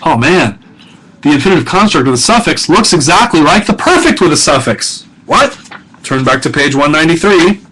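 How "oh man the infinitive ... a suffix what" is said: mock-baffled, pitch climbing higher; playful mock dismay, higher voice; baffled student. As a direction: act out a student's puzzled realization, ending on an incredulous question. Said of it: "You may say to yourself".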